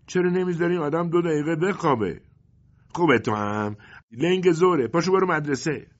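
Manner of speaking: put-on grumbling boy. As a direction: complain like a kid who wants to sleep. Sleepy boy answers mother.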